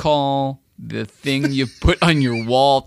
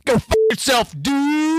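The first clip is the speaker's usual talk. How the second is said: mock Texas accent; 8 semitones higher, slower than usual. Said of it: "Dude Ranch".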